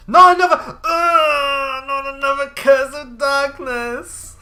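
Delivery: slow despairing wail; anguished wail. Real, not acted. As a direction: cry out in despair. Despairing cry at curse.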